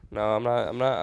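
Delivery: ordinary talk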